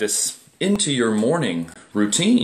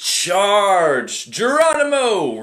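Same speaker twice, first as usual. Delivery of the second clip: drawn-out, higher charging cry; playful battle cry; 9 semitones higher, much slower than usual. Acted. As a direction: leap in with a mock war whoop. Mimes charging into cold water.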